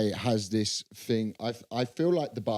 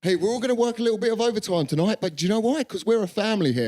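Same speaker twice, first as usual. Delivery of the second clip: higher, louder and faster, a chirpy mock manager pep talk; fake-friendly boss; 10 semitones higher, 3 dB louder, faster than usual. Mocking a too-chill boss asking for overtime, chirpy and fast. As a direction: sell the overtime like a matey fun manager.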